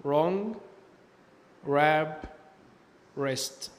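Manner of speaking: "wrong", "wrap", "wrist" starting straight on r; silent W. Shown as a demonstration. Teacher giving examples of W before R, "wrong", "wrap", "wrist" with silent W. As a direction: model "right", "wrong", "wrap", "wrist" one by one, no w sound. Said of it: "حرف ال W لا ينطق ويصبح silent".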